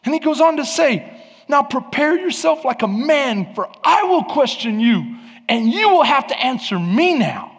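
high, emphatic preacher's voice of God; God challenging Job. Acted. God answers Job out of the storm, challenging preacher's delivery. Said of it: "he says this to Job".

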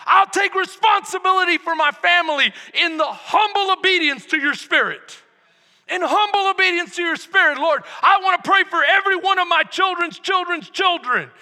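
loud, high, impassioned sermon shout; full-force preaching. Real, not acted. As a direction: preach it out as a fervent prayer.

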